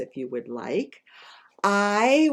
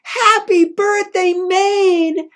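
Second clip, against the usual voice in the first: much higher, louder booming greeting; Paul Bunyan yelling birthday wishes, much higher; Paul Bunyan shout; 9 dB louder, much slower than usual. Acted. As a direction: burst out of the cake shouting. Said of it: "Help me say what Paul Bunyan said".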